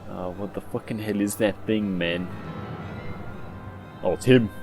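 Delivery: normal talking voice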